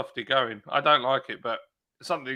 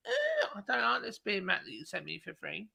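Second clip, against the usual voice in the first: mocking whiny customer; 7 semitones higher, 7 dB quieter than usual. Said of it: "they say".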